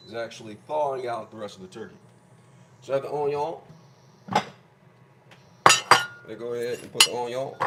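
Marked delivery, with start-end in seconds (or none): 2.8-7.5 put-on a light French accent, "onion" said like "onyon"; playful mock French accent on "onion". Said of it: "Sorry about my French accent".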